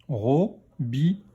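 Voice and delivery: slow "Robinet", split into ro, bi, nè; syllable by syllable. Shown as a demonstration. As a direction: sound out "Robinet" in three beats. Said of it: "Troisième syllabe".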